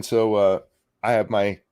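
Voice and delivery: normal talking voice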